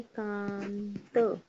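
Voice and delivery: slowly drawn-out dictation; slow dictation of Suryakant; slow spelling. Shown as a demonstration. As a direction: stretch each sound so kids can write it. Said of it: "बिंदी लगाएंगे".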